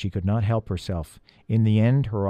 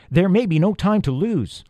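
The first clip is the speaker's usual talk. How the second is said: raised, pressing urgency; higher voice, pressing them to move; urgent cry; 7 semitones higher, 4 dB louder than usual. A narrator's reading. Peter warns friends time is short, urgent cry. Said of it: "he cried".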